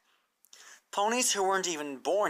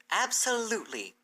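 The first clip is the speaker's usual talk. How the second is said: raised, earnest teacher pony explaining; bright scholarly mare, raised pitch; Twilight's voice; 9 semitones higher than usual. Acted. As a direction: lay out the plan to her hopeful and clear. Twilight answers firmly.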